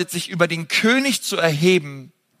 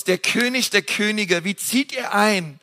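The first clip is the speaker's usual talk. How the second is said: usual speaking voice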